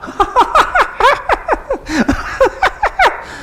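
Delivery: very high, long mock cackle of scorn; 비웃는 높은 웃음소리; mocking laugh. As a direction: laugh him off like a scornful villager. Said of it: "막 웃는 거예요".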